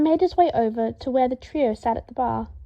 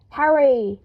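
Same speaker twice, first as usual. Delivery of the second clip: loud sudden yell of the name; sudden scream; 5 dB louder than usual. A narrator's reading. Weasley yelling at Harry beside him, abrupt loud call. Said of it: "he abruptly screamed".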